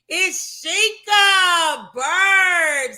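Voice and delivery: loud, high, stretched-out announcement; excited guest introduction. Real, not acted. Host introduces her guest to the audience, excited shout. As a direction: hype the guest with long, belted vowels.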